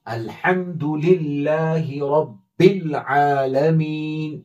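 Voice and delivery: slow, drawn-out recitation of the verse; Quran recitation demo. Shown as a demonstration. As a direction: recite it slowly and clearly for the student. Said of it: "जितनी बार आप पढ़ेंगे". (धीमी तिलावत)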